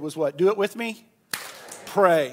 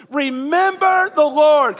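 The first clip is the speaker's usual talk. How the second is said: louder, higher, slow; said together; 6 semitones higher, 9 dB louder, slower than usual. Shown as a demonstration. Preacher leading the congregation to recite, loud and slow. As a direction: proclaim it with the crowd. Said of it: "Come on, everybody".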